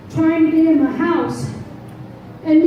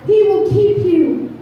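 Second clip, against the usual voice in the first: fervent preaching; 4 semitones higher than usual. Acted. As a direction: preach it to the congregation. Preaching at full force.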